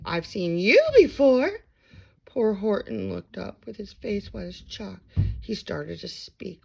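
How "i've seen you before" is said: higher, louder bird voice; Maisie's voice. A narrator's reading. Maisie recognizing Horton, higher and louder. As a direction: still breathless with surprise. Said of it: "gasped Maisie".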